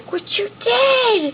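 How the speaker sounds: high, shocked question; mock shock. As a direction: gasp and ask in mock alarm.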